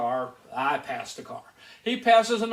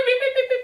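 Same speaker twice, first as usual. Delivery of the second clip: imitating radar beeps; 5 dB louder, faster than usual. Radar detector going crazy.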